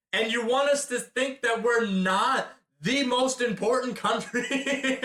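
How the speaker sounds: mock disbelief about America not being "the most important country"; sarcastic question. Real, not acted. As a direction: push the disbelief up on "want". Repeated rhetorical jab, pushed higher.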